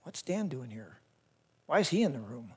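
low, hushed mock muttering; imitating wary onlookers. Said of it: "people who knew me from before would be like".

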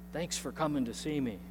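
very soft, feeble raspy voice; raspy old man. Retold or imitated. Dying grandpa thanks the visiting pastor, soft and raspy. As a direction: imitate a weak patient barely getting words out. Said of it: "with a raspy voice".

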